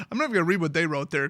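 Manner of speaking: normal talking voice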